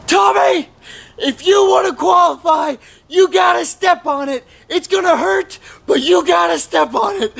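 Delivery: high-pitched urgent sideline shout; imitated screaming. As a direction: imitate a father-in-law yelling a runner on. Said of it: "they are screaming their heads off".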